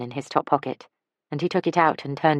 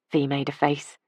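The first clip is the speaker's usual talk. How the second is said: usual speaking voice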